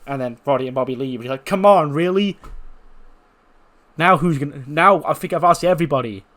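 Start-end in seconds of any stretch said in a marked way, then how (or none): 1.4-6.2 higher and louder, exasperated cry; frustrated outburst. Acted. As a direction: throw up your hands, louder. Cole left alone, frustrated.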